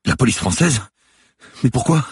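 high, loud, astonished questions; startled questioning. A narrator's reading. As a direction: react with alarm to the police card. Guard startled by police card. (questions étonnées, voix aiguë)

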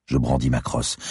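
plain reading voice

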